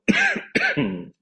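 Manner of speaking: sudden choking cough; choking on a drink. Real, not acted. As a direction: cough hard after swallowing wrong. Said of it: "ich hab mich verschluckt".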